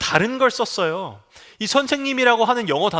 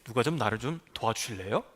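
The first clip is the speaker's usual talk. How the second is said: soft, polite mock request; a prim, well-mannered ask for help, softer; mock-polite plea, quietly; politely genteel; 8 semitones lower, 9 dB quieter than usual. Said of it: "점잖게".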